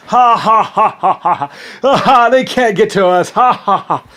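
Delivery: high, loud taunting cackle in a put-on voice; mocking laughter. Enemies taunting the narrator from his country, mocking laughter. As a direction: laugh at him as if he can't touch you.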